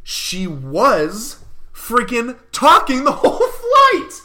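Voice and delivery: loud, slow and laughing; laughing exasperated outburst. Real, not acted. Vlogger venting to viewers, loud laughing exasperation. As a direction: blurt it out, half laughing in disbelief.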